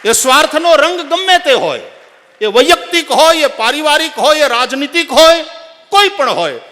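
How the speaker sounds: high, loud impassioned oratory; full-force preaching. Real, not acted. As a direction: build a list at the top of your voice. Preacher drives the moral home. (પૂરા જોશથી ઊંચા અવાજે પ્રવચન)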